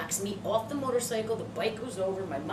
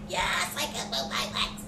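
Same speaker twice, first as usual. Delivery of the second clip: higher, strained mom reply; lightly voiced mom; 5 semitones higher than usual. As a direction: answer like an irritated mom. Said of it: "She's like".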